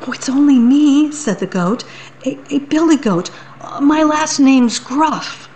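high, quick, stammering goat; nervous little goat. Acted. Little goat trembling before the troll. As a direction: squeak out the answer, scared.

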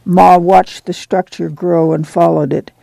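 ordinary delivery, nothing marked

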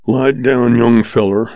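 plain reading voice

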